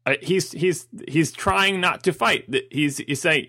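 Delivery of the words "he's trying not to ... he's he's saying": normal talking voice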